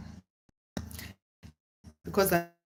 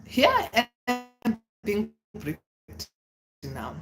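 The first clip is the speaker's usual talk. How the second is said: drawn out word by word, higher start; slow, emphatic repeat; 5 semitones higher, much slower than usual. Real, not acted. Ghosted woman lamenting her situation, slow and broken up. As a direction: spell out the misery with a gap after each word.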